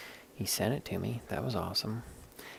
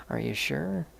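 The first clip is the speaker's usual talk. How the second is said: higher, louder doubtful check, rising at the end; pitched-up, pushy rising question; imitated insistent question; 7 semitones higher, 5 dB louder than usual. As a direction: mimic a sister pressing the point. Sister asks once more, pressing.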